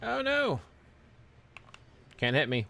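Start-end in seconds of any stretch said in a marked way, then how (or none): 0.0-0.6 dismayed cry. Real, not acted. Dismayed at game.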